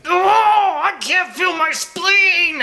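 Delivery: high, shrill-pitched mock scream of pain; hammed-up falsetto wail; mock screaming henchman. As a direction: squeal it like a hurt thug. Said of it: "screaming things like".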